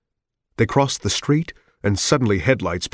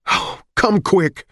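terrified; 11 semitones higher, 3 dB louder, slower than usual. Acted. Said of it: "his face full of terror".

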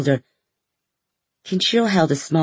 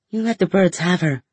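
higher, hurt accusation; upset, near cracking; 5 semitones higher than usual. A narrator's reading. Sister confronts brother over abandoned horse, distressed. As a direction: accuse him in raised pitch, distressed. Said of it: "My voice cracked".

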